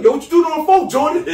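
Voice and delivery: high, loud demand through laughter; scolding uncle. Retold or imitated. Acting Bernie Mac catching Jordan on the phone, loud scolding. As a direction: holler at the kid, laughing.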